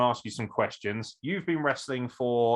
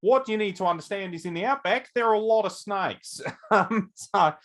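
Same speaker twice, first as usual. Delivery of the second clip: hammed-up Australian outback guide, pitched up; mock Australian accent; 7 semitones higher, 3 dB louder than usual. Host warning about outback snakes, hammed-up Australian accent. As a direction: lecture like a jokey Australian bushman. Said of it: "you've gone to an Australian accent all of a sudden".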